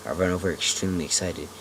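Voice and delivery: ordinary delivery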